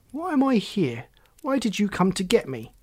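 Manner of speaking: a bit angry. Acted. Boy questions the frog who took him, a bit angry. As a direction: demand answers with a touch of anger. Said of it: "Oliver was getting a bit angry now".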